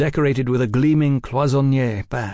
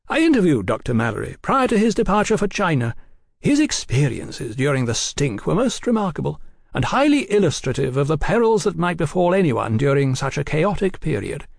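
lightly voiced, genial gentleman; higher-pitched, polite journalist's patter; cordial gentleman journalist; 6 semitones higher than usual. A narrator's reading. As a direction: explain yourself with airy cordiality. Oliphant holds forth to the agents.

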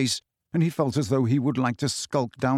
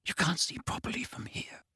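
whispering; 4 semitones higher, 11 dB quieter than usual. A narrator's reading. Creeping through dark aisles.

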